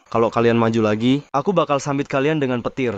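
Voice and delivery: ordinary delivery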